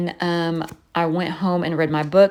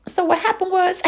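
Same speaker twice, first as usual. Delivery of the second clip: a touch high, birdlike; parrot copying me; 13 semitones higher than usual. Retold or imitated. Bird copying the owner's story voice, lightly high parrot. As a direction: keep the squawky parrot mimicry going. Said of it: "just in my voice".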